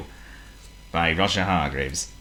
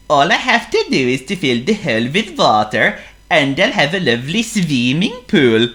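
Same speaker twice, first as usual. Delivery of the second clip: Honk the seal; 9 semitones higher, 6 dB louder than usual. Acted. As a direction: play a polite silly seal. Honk's voice.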